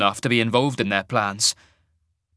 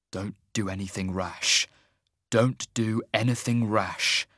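low-key, teeth-gnashing self-restraint; suppressed rage; 3 semitones lower, 5 dB quieter than usual. A narrator's reading. Half-mage repeats it to curb his rage, tightly restrained. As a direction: repeat it under your breath, holding anger in. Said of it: "Erimel repeated it over and over, the gnashing of his teeth".

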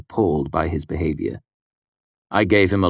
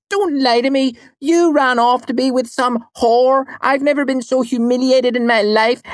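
high, loud hammed-up outrage; mock quarrel; 6 dB louder than usual. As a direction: play-act a jealous shouting match. Play-acting a jealous quarrel.

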